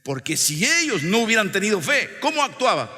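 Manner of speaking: high, loud rhetorical question; loud pulpit challenge; full-force preaching. Real, not acted. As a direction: fire the question at the congregation.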